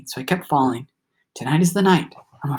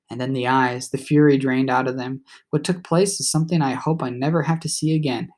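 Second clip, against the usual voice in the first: ordinary delivery, nothing marked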